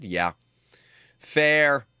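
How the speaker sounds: ordinary talk, nothing marked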